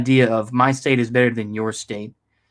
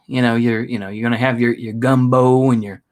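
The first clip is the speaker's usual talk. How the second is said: normal talking voice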